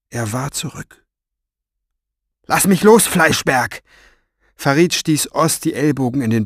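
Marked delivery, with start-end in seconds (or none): 2.5-3.8 higher, louder burst of defiance; angry protest. Acted. Farid struggles in the henchman's grip, angry. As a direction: snap at the brute holding you. Captive struggles against his captor.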